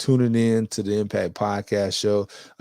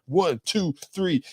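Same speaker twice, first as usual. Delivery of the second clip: higher-pitched, lightly acted referee count; mock pin count; 9 semitones higher, slower than usual. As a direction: count out a wrestling pin in playful imitation. Mimics a wrestling pin count.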